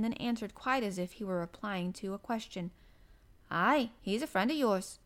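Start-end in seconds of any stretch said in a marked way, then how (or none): none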